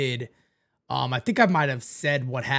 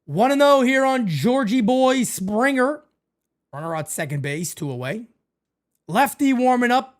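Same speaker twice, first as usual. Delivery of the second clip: play-by-play call; 7 semitones higher, 3 dB louder than usual. Acted. Streamer calling the game for chat, broadcaster style.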